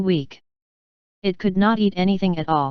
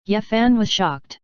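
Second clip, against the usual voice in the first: ordinary delivery, nothing marked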